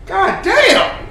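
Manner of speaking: loud, high exasperated cry; ranting outburst; exasperated shout. Real, not acted. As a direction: throw it out in disgust.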